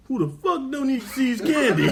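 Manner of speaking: hammed-up outraged yell; mock yell. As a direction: yell it out in disbelief, half laughing. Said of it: "She said he yells out".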